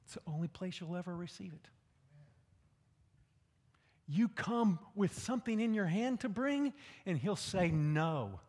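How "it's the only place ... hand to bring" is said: normal talking voice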